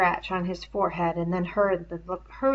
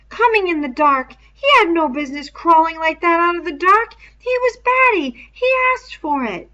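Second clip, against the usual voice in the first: much higher and louder, shrilling; 10 semitones higher, 10 dB louder than usual. A narrator's reading. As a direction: let the voice climb to a shrill pitch. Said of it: "His voice shrilled again".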